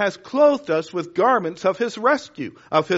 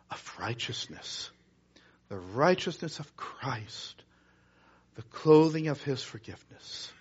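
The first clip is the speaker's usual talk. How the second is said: soft, lower sermon, fading to near whisper; hushed preaching; 3 semitones lower, 5 dB quieter than usual. Acted. Preacher closing tenderly to the church, hushed and soft. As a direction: draw them in quietly. Preacher drops to intimate close.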